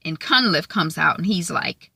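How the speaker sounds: usual speaking voice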